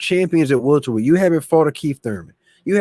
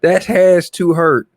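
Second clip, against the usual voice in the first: slow, louder, leaning on has; echoing Tim Bradley; 3 semitones higher, 5 dB louder, slower than usual. Retold or imitated. Host echoing Tim Bradley's clip to his viewers, slow and louder. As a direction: repeat the quote with a slow, wincing stress on has. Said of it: "That's what Tim Bradley said".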